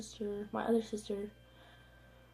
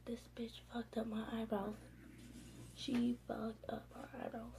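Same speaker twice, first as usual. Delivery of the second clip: hushed, near-whispered disbelief; stunned muttering; 9 dB quieter than usual. Retold or imitated. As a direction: mutter it under your breath, barely voiced. Mutters stunned to herself at salon.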